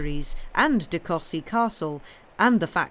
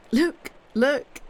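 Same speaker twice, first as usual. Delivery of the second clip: higher, louder and eager; eager look; 8 semitones higher, 5 dB louder than usual. Acted. The Signora urging Mr Slope to look, higher and louder.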